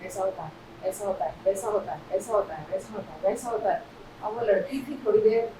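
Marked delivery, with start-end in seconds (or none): 0.0-3.8 low, monotonous rattling-off; droning on mechanically in a lower voice; rote recitation. Acted. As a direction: reel it off like a memorised presentation. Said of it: "वो बेचारा बोले जा रहा".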